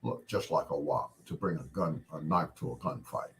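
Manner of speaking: put-on low Scottish accent, s sounds slurred to sh; Sean Connery. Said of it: "in his Scottish accent".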